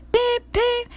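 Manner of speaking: very high beep beep like a whistle. A narrator's reading. Percy whistling thanks to Harold, high beeps. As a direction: toot cheerfully. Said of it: "he whistled".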